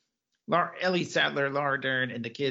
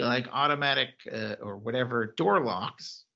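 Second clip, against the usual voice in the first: ordinary talk, nothing marked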